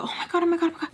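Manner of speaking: high, breathless mock swoon; mock gushing. Teasing her own imagined crush, mock gushing. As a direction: gush like a lovestruck fan, teasing it.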